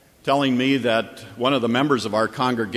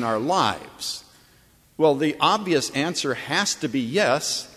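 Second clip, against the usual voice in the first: higher, building sermon cadence; preaching at full force; 6 semitones higher than usual. Acted. Pastor hammering the congregation with rising, preaching force. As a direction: drive each refrain home to the pews. Preaching the repeated master refrain.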